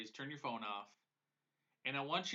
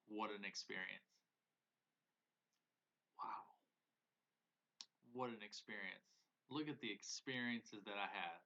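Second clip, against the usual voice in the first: wistful awe; 4 semitones lower, 8 dB quieter than usual. Pretend. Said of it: "that makes me emotional".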